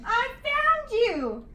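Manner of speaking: delighted cry. A narrator's reading. George finally meeting the real Yeti, delighted cry. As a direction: savor every word with a grin. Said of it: "cried George with a big happy grin".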